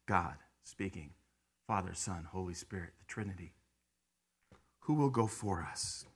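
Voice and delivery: low, soft, reverent reading; hushed close. Real, not acted. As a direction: let it fall to near silence.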